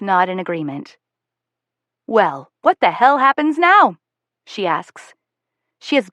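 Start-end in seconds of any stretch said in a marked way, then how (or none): 2.1-4.0 higher, louder, slow exasperated demand. A narrator's reading. Mother demanding an answer from Will and daughter, exasperated. Mother confronts, hands on hips.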